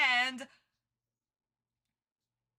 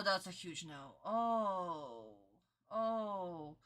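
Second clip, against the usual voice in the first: ordinary delivery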